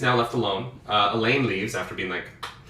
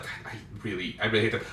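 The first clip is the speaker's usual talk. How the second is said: muttered complaint; 3 semitones lower, 8 dB quieter, faster than usual. Acted. Rand complains after lords leave.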